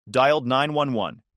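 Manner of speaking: ordinary delivery, nothing marked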